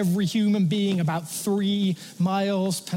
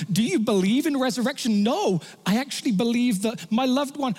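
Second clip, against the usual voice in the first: ordinary talk, nothing marked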